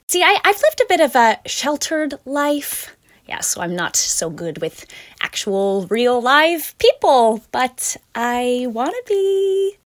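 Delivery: Anna's voice. Acted. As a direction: gush out an eager, clumsy confession. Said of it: "Anna sounds like this".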